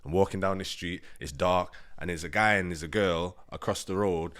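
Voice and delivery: ordinary talk, nothing marked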